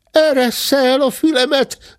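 very high cub screech; high-pitched protesting squawk; screeching bear cub. Acted. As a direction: squeal like a cub caught by the ear. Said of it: "rikácsolta a bocs".

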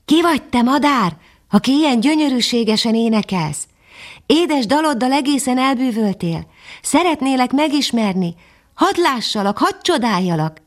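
put-on young dog Füstös calling admiringly up into the tree. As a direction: call up to the hidden singer, full of wonder. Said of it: "felkiabált a madárhoz".

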